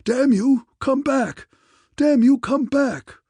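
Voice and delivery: mad, high, loud bellowing; enraged roar. A narrator's reading. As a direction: roar it out in a frenzy of rage. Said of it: "and he roared".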